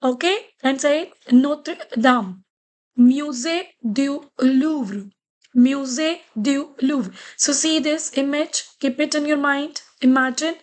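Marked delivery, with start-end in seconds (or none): 3.0-7.1 "Musée du Louvre" slowed right down, every syllable given space; slow French model. Shown as a demonstration. Teacher showing students how to say it, slow and careful. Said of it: "That's why I'm pronouncing it musée".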